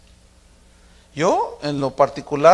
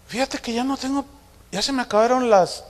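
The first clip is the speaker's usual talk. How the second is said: higher, soft roundabout hint; hinting daughter; 7 semitones higher, 3 dB quieter than usual. Retold or imitated. Daughter hints to dad about period pills, high soft voice. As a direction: imitate a daughter broaching it delicately, pitched up. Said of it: "ella busca la manera de decírmelo".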